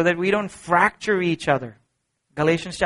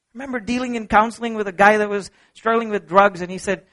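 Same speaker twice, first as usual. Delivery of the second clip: ordinary talk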